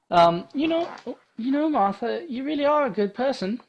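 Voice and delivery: put-on high, anxious girl voice; a young adventurer fretting; young heroine voice. Voices Ada in a story.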